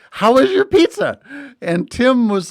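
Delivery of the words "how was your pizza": very high, eager squeal of a question; excited, high-pitched mimicry. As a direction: imitate someone bursting to know. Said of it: "Sadie was so excited".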